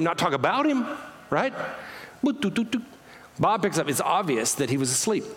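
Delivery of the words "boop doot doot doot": high boop doot of a ringing line; phone ringing. Retold or imitated. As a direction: beep the ring tones up high.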